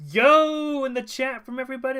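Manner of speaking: big stretched hype shout; hyped call-out. Real, not acted. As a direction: shout it out to rally the crowd. Calling for yo from the chat.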